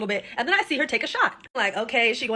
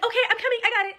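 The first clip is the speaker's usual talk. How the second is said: high, sing-song fake-sweet waitress; lightly voiced waitress, much higher; mock chirpy waitress; 11 semitones higher than usual. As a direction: mimic the waitress brushing them off. Waitress answering, mocked higher.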